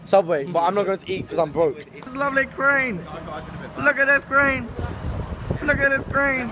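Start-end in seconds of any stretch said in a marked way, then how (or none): 1.9-6.5 high-pitched amazed voice; mock awe. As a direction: gush over it in a high voice.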